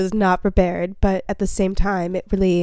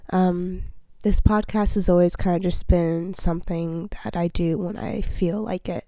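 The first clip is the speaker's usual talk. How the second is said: usual speaking voice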